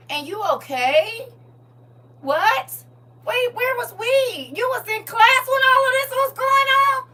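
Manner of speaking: high, loud disbelieving cry; mock astonishment, voice breaking up; shocked. Teacher imitating staff stunned by a student's story, high shocked cry.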